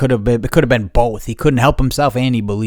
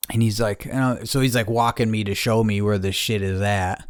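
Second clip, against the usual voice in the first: ordinary delivery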